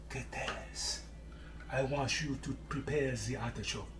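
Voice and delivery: put-on soft French accent on a chef's order; half-whispered French-accented instruction; a light, affectionate French accent imitation; Jacques Pepin French accent. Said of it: "forgive me for trying to do that French accent".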